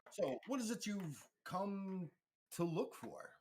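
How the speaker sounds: high, slow, drawn-out character voice; theatrical broker, high and slow; information broker's probing question. Acted. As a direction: draw out the question to your guests. GM plays information broker Switch.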